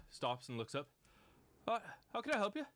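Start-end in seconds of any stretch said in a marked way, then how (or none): 1.7-2.6 high, louder innocent gnome. Acted. Gnome questioned by Rat, high and innocent.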